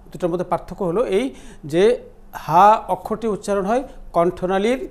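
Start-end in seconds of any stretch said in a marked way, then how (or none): none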